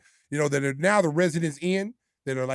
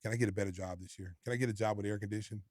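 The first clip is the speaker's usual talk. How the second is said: meek request; 6 semitones lower, 10 dB quieter, faster than usual. Retold or imitated. Meekly asking coach for job.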